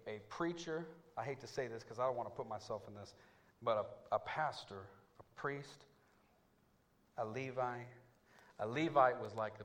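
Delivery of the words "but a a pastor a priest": ordinary talk, nothing marked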